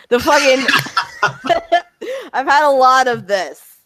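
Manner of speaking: ordinary delivery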